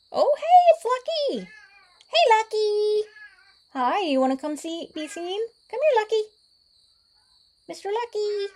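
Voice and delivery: high, loud pet-calling voice; calling a cat. Real, not acted. Vlogger coaxing the stray cat Lucky over, high loud calling. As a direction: coo loudly up high to coax the cat over.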